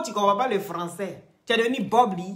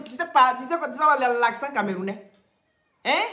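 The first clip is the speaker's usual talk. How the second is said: usual speaking voice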